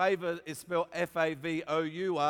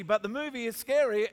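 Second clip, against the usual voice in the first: usual speaking voice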